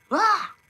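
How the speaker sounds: short, high "ah" yelp; quick cartoon yelp; short scream. Shown as a demonstration. Demonstrating voice actors' screams.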